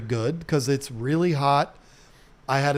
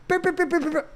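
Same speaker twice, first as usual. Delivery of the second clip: mock beeping chatter; 13 semitones higher, much faster than usual. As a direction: make her nagging sound like a silly beeper. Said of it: "she was like".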